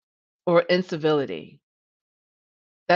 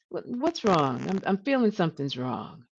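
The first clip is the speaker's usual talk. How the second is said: sighing concern; 6 dB quieter than usual. Shown as a demonstration. Manager sensing something wrong, softly and with care. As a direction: breathe out a sigh, then ask softly with care.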